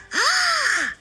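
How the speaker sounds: high sharp gasp of surprise; surprised gasp. Acted. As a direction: gasp in delight. Discovers the missing part.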